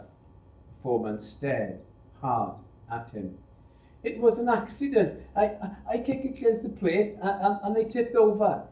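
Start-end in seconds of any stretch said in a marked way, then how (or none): 4.0-8.7 frightened stammer. Acted. Frightened boy stammers excuse.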